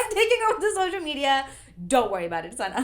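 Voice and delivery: high, fast, put-on announcer voice; a hammed-up bossy friend; mock bossy takeover. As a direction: cheerfully announce she's taking over. Mock bossy voice, big jump.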